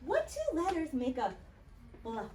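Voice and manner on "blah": normal talking voice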